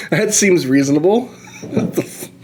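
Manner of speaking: ordinary delivery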